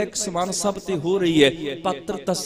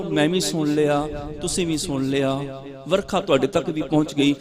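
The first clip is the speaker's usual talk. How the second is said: usual speaking voice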